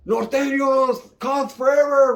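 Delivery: slow, high, woozy line; dazed last words. Retold or imitated. The big vato after the punch, slow and dazed. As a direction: slur it out like you're punched out.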